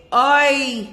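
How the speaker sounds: exclaiming Oy like a Catskills mother; mock sigh. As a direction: belt out a long-suffering Oy. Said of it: "one mother goes".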